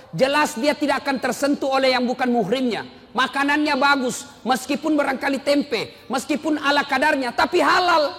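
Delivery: loud, high preacher's call pressing the point home; fiery preaching. Acted. Preacher urges parents toward the pondok, fiery sermon. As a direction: build the sermon up to a ringing pitch.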